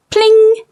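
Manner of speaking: very high ringing Pling; ping it out sharply; pling sound. A narrator's reading. The dropping lands.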